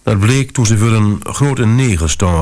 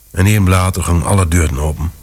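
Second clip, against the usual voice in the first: ordinary delivery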